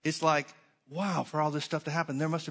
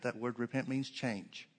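usual speaking voice

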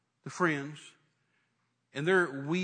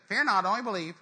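higher, louder, tossed-off proclamation; mock recital; 4 semitones higher, 5 dB louder than usual. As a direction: call it out like a stock slogan. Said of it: "I didn't just say".

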